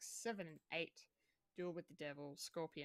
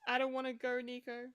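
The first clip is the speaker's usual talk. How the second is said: pleading Spider-Man quote; 5 semitones higher, 6 dB louder than usual. Retold or imitated. Quoting Peter Parker's dying line.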